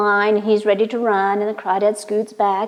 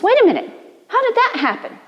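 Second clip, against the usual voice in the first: much higher, baffled voice; astonished; 12 semitones higher, faster than usual. Acted. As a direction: blurt it out in disbelief. Fox amazed after losing.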